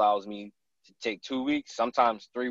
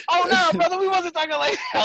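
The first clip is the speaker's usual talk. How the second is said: high, hurried backpedalling hunter; a hunter embarrassed by the question, high apology; flustered hunter; 5 dB louder than usual. Retold or imitated. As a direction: squeak out an embarrassed denial.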